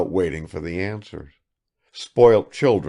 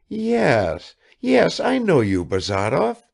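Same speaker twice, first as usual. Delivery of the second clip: lightly high, eager lady's voice; a provincial lady greeting a guest; Kukshina, high-voiced hostess; 10 semitones higher, 4 dB louder than usual. A narrator's reading. A hostess greets Bazarov, high and eager.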